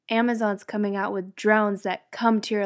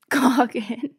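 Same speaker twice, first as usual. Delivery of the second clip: laughing; 6 semitones higher, 4 dB louder than usual. Real, not acted.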